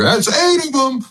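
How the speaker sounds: high-pitched mock yell; imitating Chuck yelling. Host imitates Charles yelling at missed threes with mock high yell. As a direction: squeal it like Chuck in the green room. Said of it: "Chuck was yelling".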